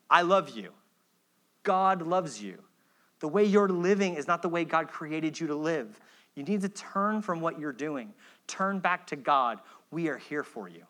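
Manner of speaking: soft, low, tender appeal; gentle plea. Shown as a demonstration. Pastor showing how to confront a friend, tender and soft.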